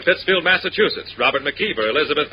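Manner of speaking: ordinary talk, nothing marked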